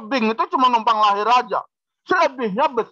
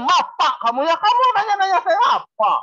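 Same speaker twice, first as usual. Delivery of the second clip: higher and fast, challenging; defiant retort; 6 semitones higher than usual. Retold or imitated. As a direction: bristle at the question. Annoyed Batak man snaps back. (membalas dengan nada menantang)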